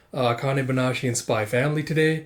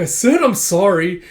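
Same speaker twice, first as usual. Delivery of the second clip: loud, higher protesting sorry; mock exasperated apology; 5 semitones higher, 8 dB louder than usual. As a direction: protest while being pummeled. Acted quarrel during battering.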